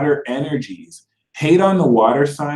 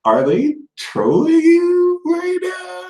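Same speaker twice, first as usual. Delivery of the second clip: much higher, slow disbelieving yell; incredulous shout; slower than usual. Retold or imitated. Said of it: "you have to look like".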